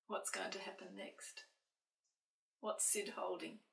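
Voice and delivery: soft, breathy hushed question; hushed aside. A narrator's reading. Storyteller hushes to ask the children what comes next. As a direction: lean in and ask the children softly. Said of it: "asking the listening children".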